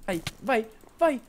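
cheering his friend on as the kill nears; building cheer. Real, not acted. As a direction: climb up into a frantic cheer. Urging friend during a fight.

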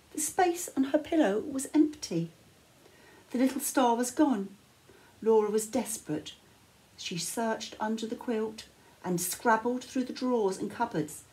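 plain reading voice

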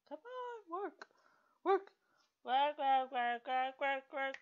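high, loud frustrated yell of work; yelling at the game. Real, not acted. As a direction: shout at the frozen game to work.

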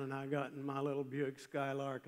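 ordinary talk

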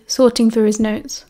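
usual speaking voice

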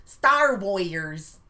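'Star Warriors' very slow and loud, every syllable drawn out; over-enunciated. Shown as a demonstration. Southerner shows co-host the unaccustomed word, over-enunciated. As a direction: stretch out 'Star Warriors' to show the full word. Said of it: "We don't say Warriors".

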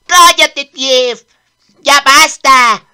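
loud, furious yelling at the servant; angry shout. Acted. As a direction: snap at him to shut up, full volume. Prince scolds Tief loudly.

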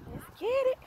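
high, loud hype-up cry; hype shout. Real, not acted. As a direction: shout it to fire yourself up. Hyping herself up mid-workout.

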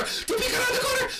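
high excited repeat, shouted. Real, not acted. Gamer shouting the repeat to his viewers, excited. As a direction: yell it out in delight.

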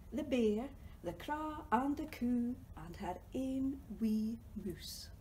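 ordinary talk

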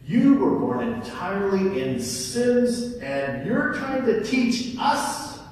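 loud, high scornful jeer; contemptuous insult. Acted. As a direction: sneer down at him. Pharisees insult the man.

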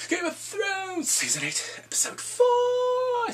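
high, slow shouted episode title; hyped title announcement. Real, not acted. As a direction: stretch and belt out the key words.

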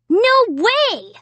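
high indignant protest. A narrator's reading. Jed protesting his innocence, high indignant. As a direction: deny it hotly. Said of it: "Jed protested".

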